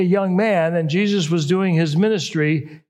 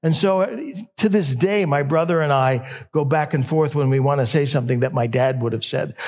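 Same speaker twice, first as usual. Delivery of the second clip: ordinary delivery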